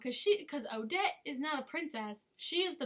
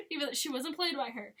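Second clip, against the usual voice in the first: ordinary delivery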